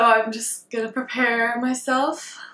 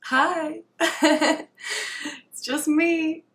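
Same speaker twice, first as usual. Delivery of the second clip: higher-pitched hello with a laugh; giggly greeting; 5 semitones higher, much slower than usual. Real, not acted. Greeting Instagram viewers on going live, giggly and self-conscious.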